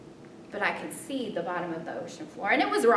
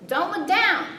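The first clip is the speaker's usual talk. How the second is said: shouted high and loud from a distance; yelled; 10 semitones higher, 9 dB louder than usual. Retold or imitated. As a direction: call it out to someone far ahead. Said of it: "she yelled from behind me".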